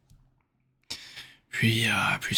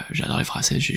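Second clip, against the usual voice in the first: normal talking voice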